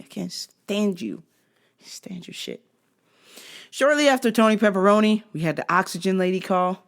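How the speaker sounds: ordinary talk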